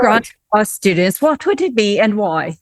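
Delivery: American attempting a British accent. Shown as a demonstration. Podcast host asks guest the last question in a put-on British accent. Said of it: "ask you in my British accent".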